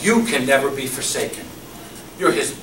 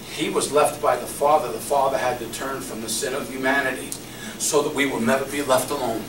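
ordinary talk

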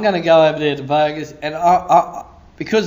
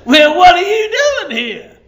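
high, loud, laughing question; hammed-up demand, pitched way up; incredulous challenge; 14 semitones higher, 7 dB louder than usual. Imagined stranger challenges preacher in Vegas, loud mock incredulity.

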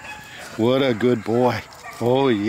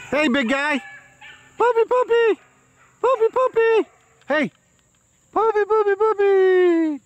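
squeaky puppy talk. Real, not acted. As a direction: squeal at the puppy like a delighted owner. High-pitched baby talk to puppy.

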